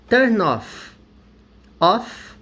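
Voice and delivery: "off" ending in a crisp F sound, not V; teacher contrasting "off" with "of". Shown as a demonstration. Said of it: "Aqui tem som de F".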